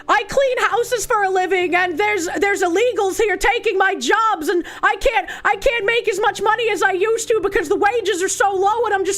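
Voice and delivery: mocking TV mom. Streamer mocking the TV show mom, whiny impression. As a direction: parody a complaining mom from a TV show. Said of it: "the mom is like".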